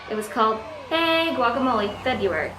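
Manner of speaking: normal talking voice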